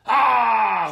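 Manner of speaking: loud high roaring cry. Acted. Nation roars at the little man's question, loud roar. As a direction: let out a huge shouted Ah. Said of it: "Rugit Nation".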